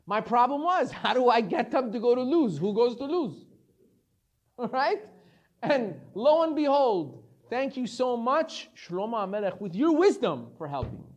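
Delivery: loud, gloating angel of death; gleeful angel. Acted. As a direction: crow about the trick, delighted. Angel gleefully thanks Shlomo.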